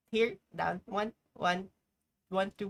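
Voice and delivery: normal talking voice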